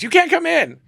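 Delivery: high, loud tearful voice of the model; crying model. Retold or imitated. Crying model refusing him entry to the closet, high and loud. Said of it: "She goes".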